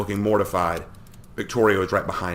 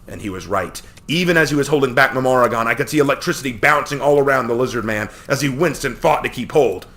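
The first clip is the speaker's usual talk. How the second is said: plain reading voice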